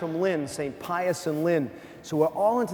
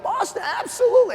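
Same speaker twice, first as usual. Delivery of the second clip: Boston shot up high, eager yes; excited priest; 5 dB louder, faster than usual. Retold or imitated. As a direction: play the priest jumping at the offer. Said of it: "He's like".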